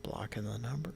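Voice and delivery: soft aside whisper. Real, not acted. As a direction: murmur it under the breath.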